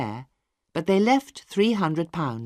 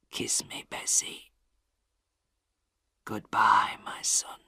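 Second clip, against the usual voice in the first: much lower, soft, breathy last whisper; 7 semitones lower, 5 dB quieter, slower than usual. A narrator's reading. Dying father asking Maggie for a kiss, faint whisper. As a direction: barely breathe the words.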